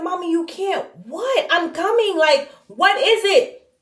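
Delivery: high, alarmed and urgent; panicked daughter. Retold or imitated. Daughter answering her crying mother, alarmed and urgent. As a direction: rush the words out in alarm.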